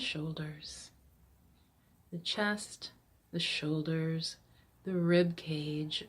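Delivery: calm body-scan voice with long pauses; guided meditation. Acted. Coach guides seated listeners through body scan, guided meditation. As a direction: guide them gently from one body part to the next.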